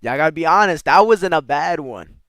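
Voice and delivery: loud, high burst of pride; loudly insisting you did well; excited self-praise. Real, not acted. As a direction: shout it, delighted with your own attempt. Pleased with own pronunciation attempt.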